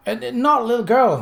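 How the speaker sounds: put-on high, loud young boy voice; eager child correcting; excited boy. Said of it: "the boy said".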